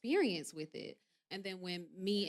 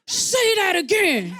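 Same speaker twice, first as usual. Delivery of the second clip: loud, high, drawn-out confrontational yell; retold challenge; 8 semitones higher, 13 dB louder, slower than usual. Couple on live confronting critics, comic loud challenge. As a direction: dare them, hammed up at full volume.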